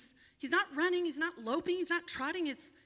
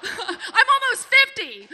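laughing; 9 semitones higher, 13 dB louder, slower than usual. Real, not acted. Laughing at her age.

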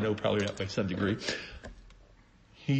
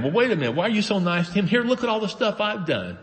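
higher, indignant complaint, mildly acted; the older son protests in mild mock indignation; resentful protest; 8 semitones higher than usual. Older son complaining to his dad, mock resentful. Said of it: "Where the resentment comes in".

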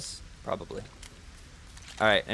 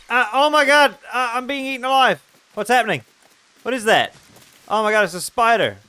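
panicked screaming; 9 semitones higher, 10 dB louder than usual. Real, not acted. Attacked by spider in game.

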